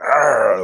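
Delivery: short mock growl like a dog. Shown as a demonstration. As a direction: growl once, half-playful. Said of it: "that dog in you".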